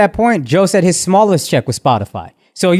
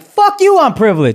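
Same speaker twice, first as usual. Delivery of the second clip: mock angry outburst; 8 semitones higher than usual.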